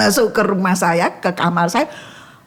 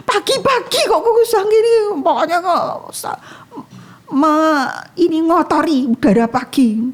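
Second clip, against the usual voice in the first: high, fast, fuming at the neighbor; annoyed neighbor rant, pitched high; angry outburst; 9 semitones higher than usual. Retold or imitated. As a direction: spit it out at the smoky neighbor. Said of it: "begitu dar gitu keluarnya marah".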